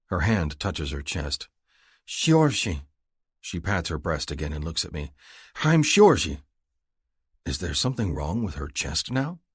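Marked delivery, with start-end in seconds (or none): 2.1-2.9 higher, gentle woman's voice introducing herself; young woman's voice. A narrator's reading. Woman says her own name. 5.6-6.4 higher and louder woman's voice, insisting; young woman's voice. A narrator's reading. Woman repeats her name.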